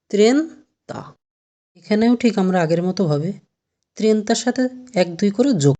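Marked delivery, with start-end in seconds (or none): 0.1-1.1 ত্রেনতা drawn out very slowly, as Italian trenta; slow pronunciation demo. Shown as a demonstration. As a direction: stretch ত্রেনতা out slowly and clearly. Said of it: "তিরিশের কি হবে?".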